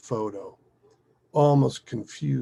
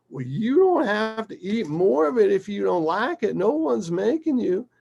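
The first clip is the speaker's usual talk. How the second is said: coaxing father; 10 semitones higher, 5 dB louder than usual. Retold or imitated. Father reasoning with child, retold.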